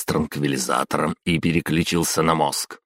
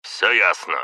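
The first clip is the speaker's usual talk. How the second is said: curt; 4 semitones higher than usual. A narrator's reading. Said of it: "повторила Ишии".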